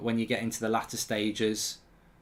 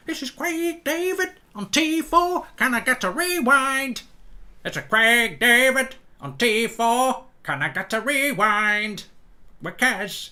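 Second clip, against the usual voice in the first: much higher, slow, loud hammed-up [name]; [name] impression; 13 semitones higher, 7 dB louder, slower than usual. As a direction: falsetto pop-star voice, drawn out. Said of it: "didn't really sound anything like him".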